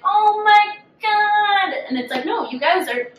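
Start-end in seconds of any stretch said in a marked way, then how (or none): 0.0-1.7 high, slow sing-song mock horror; mock shocked gasp. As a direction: drag it out, hammed-up shock. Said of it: "everyone's gonna be like".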